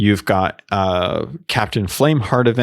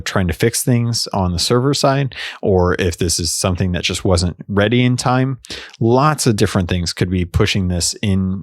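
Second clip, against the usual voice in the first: ordinary talk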